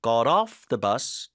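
"off" in "got off" pressed slow and firm; stress on off. Shown as a demonstration. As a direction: put the weight on the back word "off". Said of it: "뒤에 힘 주고".

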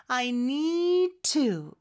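NEED TO linked, the D held back and only the T released; slow demo of "need to" with one strong T. Shown as a demonstration. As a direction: hold the d of "need", then hit the t of "to". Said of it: "D를 발음하는 것처럼 가다가 T를 발음".

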